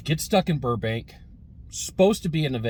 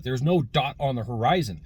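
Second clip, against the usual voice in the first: ordinary delivery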